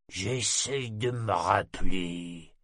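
bredouille lentement, ivre; voix bredouillante. A narrator's reading. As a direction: peine à articuler de sommeil. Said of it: "d'une voix bredouillante". (matelot soûl qui bredouille en cherchant le souvenir)

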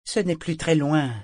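eager merchant reassuring his companion in the fog; Mingsley, eager merchant. A narrator's reading. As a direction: press on, coaxing your companion. Mingsley's dialogue in audiobook.

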